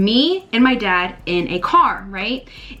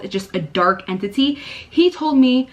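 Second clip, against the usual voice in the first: normal talking voice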